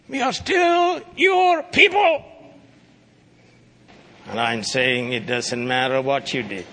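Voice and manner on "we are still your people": high, slow, loud triumphant shout; shouted proclamation. Shown as a demonstration. Preacher shouting the people's hope back at the congregation, high slow triumphant shout. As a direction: proclaim it with full joy. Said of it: "repeated with a shout".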